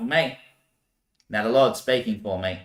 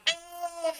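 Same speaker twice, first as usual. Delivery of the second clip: thin high wail, then softer; high cry; 11 dB quieter than usual. Retold or imitated. The fruit being opened, a high wail. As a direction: let out a pained cry. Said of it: "would scream".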